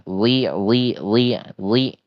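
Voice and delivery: thick Arabic dha before kasra; thick li sound. Shown as a demonstration. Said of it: "ada tambahan suara".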